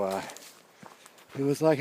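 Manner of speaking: normal talking voice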